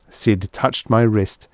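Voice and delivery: usual speaking voice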